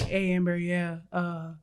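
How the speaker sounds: low, soft imitation of a male friend. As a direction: drop low for the friend on the phone. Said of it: "he has, like, a deeper voice".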